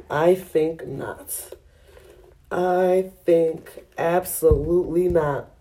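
slow, deliberate no; slow firm refusal. Real, not acted. Refusing a round two, slow and firm. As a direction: spell out the refusal slowly.

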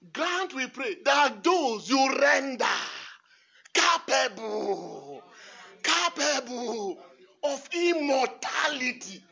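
loud and very slow, stretching capable; slow shouted proclamation. Acted. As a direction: hammer out every word. Shouting the prayer slowly.

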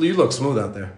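usual speaking voice

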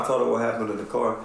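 ordinary delivery

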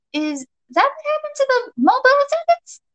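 very high-pitched nervous question; squeaky disbelieving query; shocked squeak. A narrator's reading. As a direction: let the voice jump right up. Shocked listener asks, voice shoots up.

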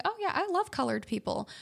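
high, enthusiastic grandma quote; imitated grandma. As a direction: lightly voice a gushing grandma. Voicing grandma's cheerful remark.

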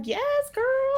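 put-on high squeaky yes girl; a delighted falsetto cheer; cheering her on. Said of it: "I was like".

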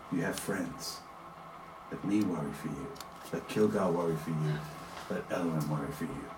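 low, soft and soothing; gently steady a worried friend; hushed, calming game character; reassuring friend. Acted. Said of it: "puts his hands on your shoulders as he says".

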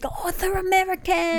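high-pitched mock British girl; British accent. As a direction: imitate an obnoxious onlooker in a British accent. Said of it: "I used a British accent".

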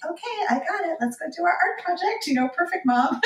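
high, bright self-parody of a supermom; mock perfect mom. Exhausted mom mocking her cheerful supermom self, high and bright. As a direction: overdo the cheerful can-do mom, tongue in cheek. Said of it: "normally I'd be like".

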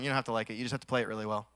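low, unimpressed teacher's reply; band teacher. Retold or imitated. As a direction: lightly imitate a teacher brushing off a complaint. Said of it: "It's always what he'd say".

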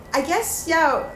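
usual speaking voice

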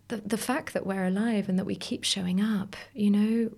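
ordinary talk

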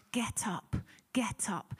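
breathless whisper, willing him to rise; whispered urging. Retold or imitated. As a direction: whisper it like you're urging from the stands. Crowd urging runner on.

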